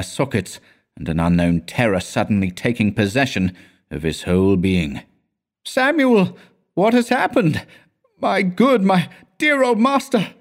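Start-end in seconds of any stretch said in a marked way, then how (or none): 5.6-10.3 very high, alarmed cry; frightened calling out. A narrator's reading. Pupil calling to his silent old master, high frightened cry. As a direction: call out in dread, voice leaping high. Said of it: "he called out".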